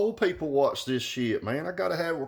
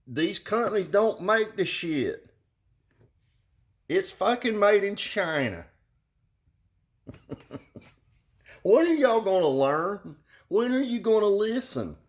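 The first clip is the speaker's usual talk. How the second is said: exasperated rant; 10 semitones higher than usual. Retold or imitated.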